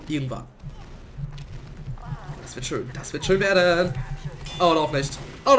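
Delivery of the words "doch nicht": ordinary talk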